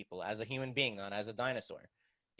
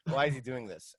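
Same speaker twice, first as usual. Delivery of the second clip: laughing through the question; chuckling while asking; 3 dB louder than usual. Retold or imitated. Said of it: "So I was like".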